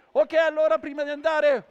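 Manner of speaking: loud, high address to the crowd; projected, high showman's call. Real, not acted. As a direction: get the whole audience's attention. Performer addressing crowd at show's end.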